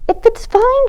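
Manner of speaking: put-on insisting child. Katie insists on saucepan.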